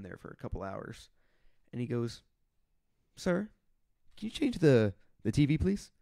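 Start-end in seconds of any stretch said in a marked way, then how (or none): 3.2-5.9 higher, louder pleading voice; imitated detainee request. Detainee asks officer to change the TV, imitated plea. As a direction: put on a polite, raised asking voice.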